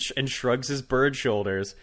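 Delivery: normal talking voice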